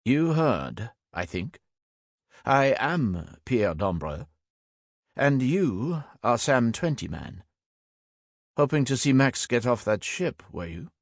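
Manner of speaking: put-on measured French-accented English, deliberate on the names; lightly French, knowing and unhurried. A narrator's reading. Frenchman confronting Sam about Max, light French accent. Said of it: "there was a French accent bubbling beneath it".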